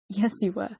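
usual speaking voice

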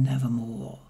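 low, toneless raven whisper; raven's reply. A narrator's reading. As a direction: breathe it out like a raven in the shadows. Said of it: "Quoth the raven". (tief geflüstertes Rabenwort)

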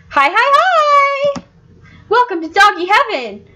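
very high, excited squeaky greeting; cheerful doggy greeter. Acted. Heaven's dog welcoming a newcomer, high-pitched and cheerful. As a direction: chirp it like an overjoyed heaven host. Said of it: "she just says it so cheerfully".

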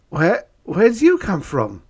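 high, loud stuttering question; frightened stammer. Acted. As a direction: stammer it out in fear.

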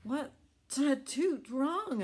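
high, slow sneezy question; little tugboat asking, high and slow. Acted. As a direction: ask it high through a sneeze. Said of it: "asked Alice".

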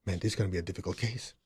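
muttered thought. Retold or imitated. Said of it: "And I'm going".

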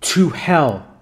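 short, firm narrated command; mildly forceful exorcist's order; categorical command. A narrator's reading. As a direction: order it with finality. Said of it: "came the categorical command".

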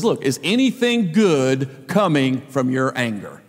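calm, unhurried fatherly rebuke; gentle God voice. Retold or imitated. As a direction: ask it patiently like a kind father. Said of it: "What's God's tone with this petulant prophet".

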